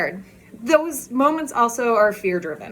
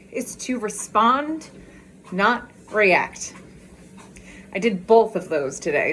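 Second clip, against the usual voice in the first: ordinary talk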